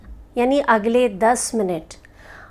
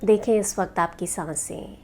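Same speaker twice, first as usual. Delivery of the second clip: guided meditation. Acted. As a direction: lead them gently into rest, unhurried.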